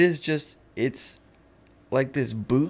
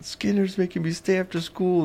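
higher cartoon kid voice; Bart quote; 8 semitones higher than usual. Quoting Bart to a friend, higher cartoon kid voice. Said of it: "It's like".

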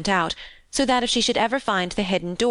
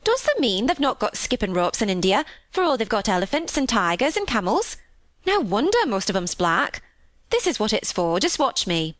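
lilting Yorkshire maid, incredulous; marvel, then offer to show; amazed country housemaid; 3 semitones higher than usual. Acted. Maid astonished India lacks skipping ropes, amazed.

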